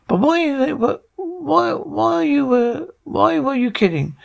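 high-pitched, stumbling puzzlement; baffled questioning. Acted. Reading Jamie's part to ask why, baffled and high. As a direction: stammer out the why, pitched well up.

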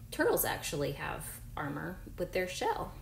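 normal talking voice

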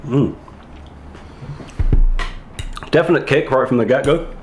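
chewing while talking; talking between bites; mouth full of noodles. Real, not acted. Seen 0: Left speaker ate noodles just before; lips pursed, hand covers mouth.

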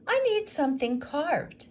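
widow's voice. Acted. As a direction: ask plainly for a job. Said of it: "said the woman".

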